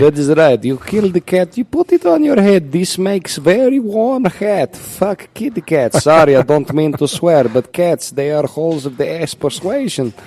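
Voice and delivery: put-on loud, high comic Russian accent. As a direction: declaim it as a hammed-up Russian. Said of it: "it will be the Russian".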